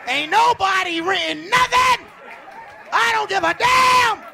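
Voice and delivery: high, loud outburst; defiant shout. Acted. Fired worker's defiant outburst.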